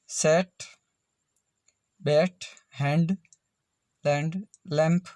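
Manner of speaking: "सेट, बैट, हैंड, लैंड, लैंप" spaced out, each A sounded as ए; short A demo. Shown as a demonstration. Teacher listing examples for learners, carefully spacing "सेट, बैट, हैंड, लैंड, लैंप". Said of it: "इन सभी में उच्चारण ए का ए हुआ".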